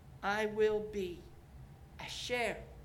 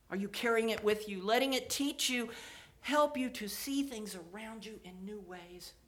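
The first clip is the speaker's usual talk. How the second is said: normal talking voice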